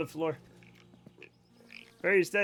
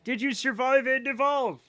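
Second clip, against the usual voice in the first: high, loud, eager questions; excited welcome; 5 semitones higher, 6 dB louder than usual. Real, not acted. Streamer asking arriving raider about their stream, excited. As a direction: greet them with raised, eager energy.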